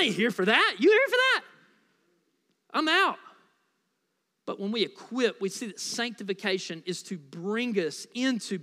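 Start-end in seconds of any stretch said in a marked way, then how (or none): 0.8-3.2 much higher and louder, playful incredulous jab; incredulous playful call-out; teasing challenge. Acted. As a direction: dare the crowd with mock disbelief.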